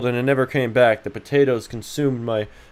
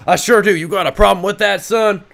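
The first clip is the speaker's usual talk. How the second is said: tough-guy challenge; 11 semitones higher, 7 dB louder than usual. Pretend. Streamer squaring up to chat, mock tough-guy challenge.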